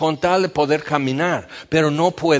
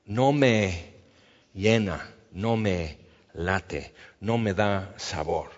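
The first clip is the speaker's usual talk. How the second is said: low, slow and soft, like nothing tastes of anything anymore; a listless believer sighing he feels nothing; weary complaint; 7 semitones lower, 4 dB quieter, slower than usual. Retold or imitated. A churchgoer confessing emptiness, low and slow. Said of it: "pero de repente dices".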